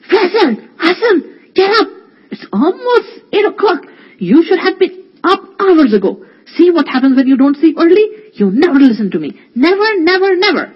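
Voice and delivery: high, loud, tearful yelling; angry scream. Acted. Faraz yanking the covers off his sleeping brothers, screaming. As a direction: scream them awake in fury. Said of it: "and screamed".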